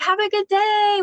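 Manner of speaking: sing-song high goodbye; a stranger wishing them well, high and bubbly; high cheery farewell. Retold or imitated. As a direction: trill it out way up high. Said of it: "And she was like".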